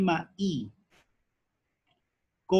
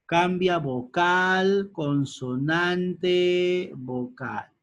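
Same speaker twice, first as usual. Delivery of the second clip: slow dictation; 3 semitones higher, much slower than usual. Shown as a demonstration. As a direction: dictate each term slowly so it sinks in. (cambia vocal, consonante, dictado muy lento)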